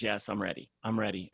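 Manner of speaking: soft and vulnerable. Retold or imitated. A client quietly agreeing she is ready to let go. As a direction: give in softly, almost surrendering. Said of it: "She became this soft, extremely vulnerable person".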